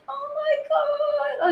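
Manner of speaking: very high, slow, sobbing wail; imitating her bawling. Streamer retelling how she wept at the TikTok, high sobbing wail. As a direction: replay your own crying over the dog video, stretched out and tearful. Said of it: "I was bawling".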